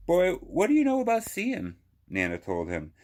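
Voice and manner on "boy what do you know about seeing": put-on higher, swooping "Boy" with a playful scold; grandmother's teasing reproach. Nana playfully scolding CJ about seeing. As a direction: tease the boy like a wise grandma.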